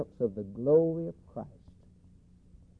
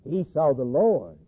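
ordinary talk

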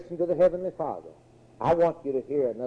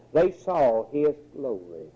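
ordinary talk

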